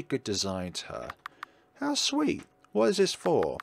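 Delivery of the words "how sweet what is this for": delighted witch. Acted. Witch thanked with flower.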